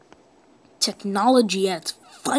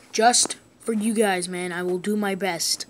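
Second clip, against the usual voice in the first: ordinary talk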